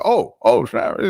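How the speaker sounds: high excited oh snap; retold surprise. Host recalling seeing his clip go viral, high and excited. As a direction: pop up in delighted surprise. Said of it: "I'm like".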